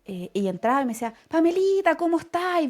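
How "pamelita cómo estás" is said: a high, loud, sugary hello from the manager; mock-sweet "Pamelita", pitched up; sweet greeting. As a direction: imitate the once-rude boss now all charm. Manager now greets her sweetly.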